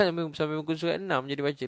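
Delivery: high, loud, rushed through laughter; words tumbling out on a laugh; amused, laughing. Real, not acted. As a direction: laugh while blurting it out fast. Amused at readers stopping at page six.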